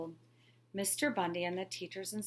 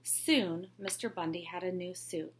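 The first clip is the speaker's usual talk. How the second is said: ordinary delivery, nothing marked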